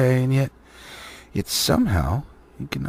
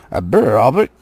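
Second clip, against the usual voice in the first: startled trolls; 9 dB louder than usual. A narrator's reading. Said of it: "a bit startled".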